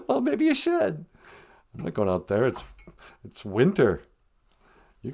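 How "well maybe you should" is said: grandma character voice. Pretend. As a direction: chirp it like a sweet old granny. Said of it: "Nana Bonjour".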